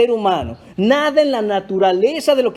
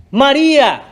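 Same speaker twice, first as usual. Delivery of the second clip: loud, higher-pitched call of the name; exclamatory shout; 4 semitones higher, 5 dB louder than usual. Acted. Jesús calls out to María, exclamatory shout. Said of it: "no grito por gusto".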